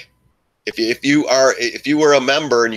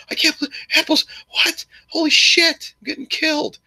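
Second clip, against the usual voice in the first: much higher, squeaky mock panic; panicked dip buyer. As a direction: play a hammed-up bagholder losing it. Mocking panicked Apple dip buyers.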